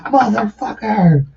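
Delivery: high, drawn-out mock yell; mock exasperation. Friends fed up with his repeat plays, mock exasperated. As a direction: draw the curse out high, playfully fed up.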